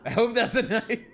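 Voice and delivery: nervous laugh at the knife. Real, not acted. Reacting to Yuta's knife, laughing.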